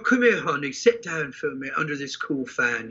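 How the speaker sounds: lightly imitated English accent, gentle; coaxing a pregnant fan to sit down, in a mild English accent. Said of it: "he's got that English accent".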